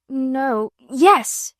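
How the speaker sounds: stammering. A narrator's reading. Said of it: "Miss Amelia stammered again".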